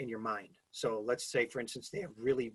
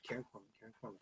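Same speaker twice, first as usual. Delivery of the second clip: soft murmured name drill, much softer; repeating under breath; 12 dB quieter, much faster than usual. Shown as a demonstration. Speaker demonstrating memorizing a name, soft murmured repetition. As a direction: say the name quietly to yourself. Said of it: "You know, in your head".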